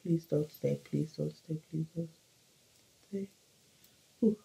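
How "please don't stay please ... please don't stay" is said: soft begging, fading; hushed repeated please; quiet pleading. Real, not acted. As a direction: murmur a hopeful plea. Pleading to the lash.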